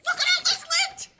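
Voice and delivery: high, shrill-edged yell over the lint; yelling at husband. Acted. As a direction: scold him at full pitch. Her yelling played on tape.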